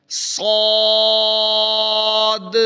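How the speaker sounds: صاد said full-mouthed, with the vowel held long and a light echo on the final د; heavy long ṣād. Shown as a demonstration. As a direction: fill the mouth on صاد and stretch it. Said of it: "آپ نے یہاں یہ لفظ نکالنا ہے".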